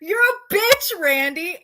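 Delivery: high-pitched acted insult; mock shriek. Amber Lynn insulting her nemesis, mock shriek. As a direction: throw it out high, as a hammed-up shriek. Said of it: "Amber Lynn shrieked".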